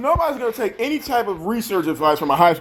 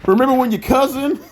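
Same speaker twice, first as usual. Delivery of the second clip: laughing; 6 semitones higher than usual. Real, not acted. Taunting her with old story.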